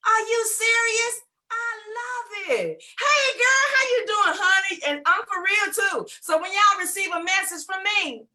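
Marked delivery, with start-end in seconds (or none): 0.1-4.8 high, bright delighted voice; excited. Real, not acted. [name] delighted about being paid, high excited. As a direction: bubble over with joy.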